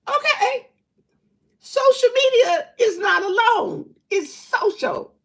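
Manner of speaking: high, loud and indignant, half laughing; worked-up rant. Real, not acted. Calling out the woman who lied online, high excited rant. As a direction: call her out, voice way up high.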